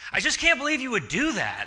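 lightly voiced wife, hammed-up hurt; accusing wife.

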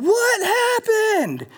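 very high, slow, drawn-out cry of alarm; wife on the phone, hearing a crash, alarmed. Retold or imitated. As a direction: stretch it out like a startled wife on the phone. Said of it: "I can hear her on the phone".

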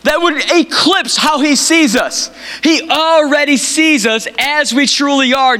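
high-pitched preaching to a congregation; impassioned preaching. Real, not acted. Preacher telling a church God sees through the image, impassioned.

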